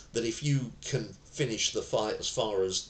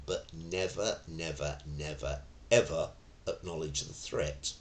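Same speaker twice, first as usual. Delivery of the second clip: ordinary talk, nothing marked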